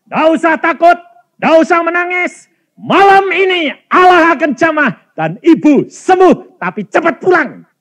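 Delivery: high, full-force proclamation; loud, urgent encouragement; shouted reassurance. Retold or imitated. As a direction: call it out like a rousing promise. Preacher acts out urgent reassuring message.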